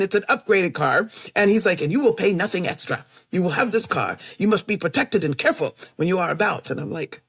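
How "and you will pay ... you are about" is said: imitated heavy Eastern European accent. Rental man promising her a free upgraded car, Eastern European accent. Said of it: "He has a heavy accent".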